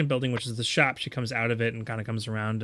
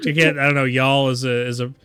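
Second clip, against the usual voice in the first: ordinary talk, nothing marked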